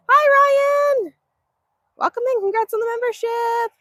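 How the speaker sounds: high, bright livestream welcome; sing-song greeting. Real, not acted. Farm vlogger welcoming new member Ryan, high sing-song.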